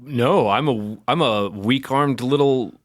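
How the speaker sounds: ordinary delivery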